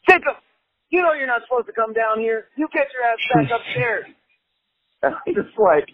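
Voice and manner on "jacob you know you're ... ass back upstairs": higher, stern telling-off; scolding host. Retold or imitated. Host Darren scolds Jacob back upstairs, high and stern. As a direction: send the kid back upstairs like a parent. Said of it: "And I hear Darren go".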